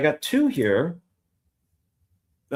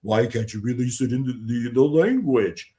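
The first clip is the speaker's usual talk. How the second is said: put-on voice ridiculing people's complaints; mocking complainer. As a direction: imitate a petty critic, stretching the last word. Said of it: "it just cracks me up with people".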